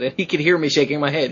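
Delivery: ordinary talk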